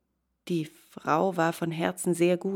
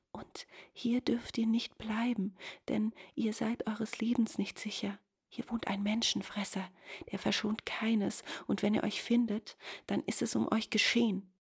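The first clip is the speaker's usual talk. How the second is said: soft, breath-filled secretive warning; half-whispered, higher woman's voice; hushed warning; 4 semitones higher, 6 dB quieter than usual. A narrator's reading. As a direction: warn him under your breath. Frightened wife warns the youth.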